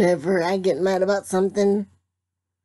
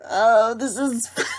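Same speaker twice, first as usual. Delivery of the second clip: usual speaking voice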